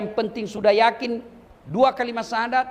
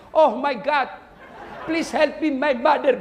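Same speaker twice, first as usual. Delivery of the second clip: mock English prayer; 7 semitones higher than usual. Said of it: "dia berdoa pakai bahasa Inggris?".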